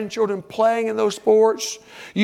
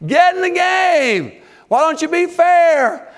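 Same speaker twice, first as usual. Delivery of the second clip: shouting; 8 semitones higher, 3 dB louder than usual. Acted. Fans to the referee, shouting at top of lungs. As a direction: holler it at the top of your lungs. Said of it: "shouting to the top of our lungs".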